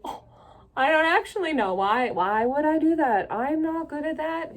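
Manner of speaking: embarrassed fluster. Retold or imitated. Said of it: "First, I was a little embarrassed".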